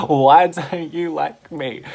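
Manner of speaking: very loud, slow mock yell; aggressive demand. Stranger slaps her leg, loud aggressive demand. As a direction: imitate her demanding it at full volume, dragged out. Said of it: "slapped my leg".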